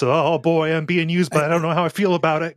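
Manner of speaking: high, anxious hapless guy; hapless pushover voice. Retold or imitated. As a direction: play a nervous, used little man. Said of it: "kinda guy".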